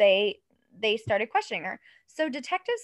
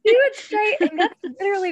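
laughing; 9 semitones higher, 6 dB louder, faster than usual. Real, not acted. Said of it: "laughing commentary".

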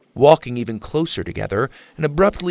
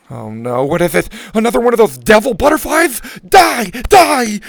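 panicked shouting; 11 semitones higher, 9 dB louder than usual. Acted. Lainey swats at a bug.

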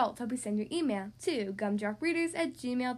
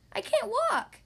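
much higher, plaintive complaint; whiny stuck skeleton; 10 semitones higher, 4 dB louder, slower than usual. Acted. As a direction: whimper like a stuck kid.

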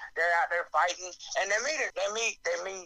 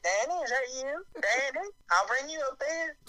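a touch higher, lightly mock-delighted; greeting Danny; 8 semitones higher than usual. Recognizing Danny from Roseanne, mild mock delight. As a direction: call out to him like an old friend. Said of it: "you hear him and you're just like".

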